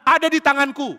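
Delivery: high, loud announcement; calling out. Acted. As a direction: proclaim it triumphantly across a distance. Daud mengumumkan dari jauh.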